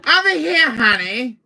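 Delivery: loud, high-pitched sing-out; squealing little villain; Salazar voice. Acted. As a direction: call out teasingly in a silly high voice. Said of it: "who's doing the Salazar voice?".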